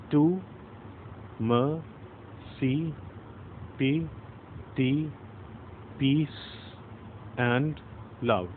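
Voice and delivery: slow, soft, each word set apart with pauses; counting syllables slowly. Shown as a demonstration. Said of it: "So let's count".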